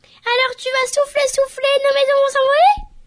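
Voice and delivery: high, loud squeaky piglet; little piglet voice. Acted. Piglets asking the wolf about blowing, high anxious piglet voice. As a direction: squeal it like a frightened little pig.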